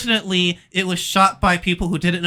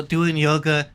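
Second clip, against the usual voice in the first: ordinary talk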